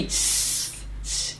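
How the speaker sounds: soft unvoiced sibilant "tss"; tongue-tip tss. Shown as a demonstration. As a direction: make a quiet hissed tss. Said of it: "pero dicen así". (chasquido suave «tss»)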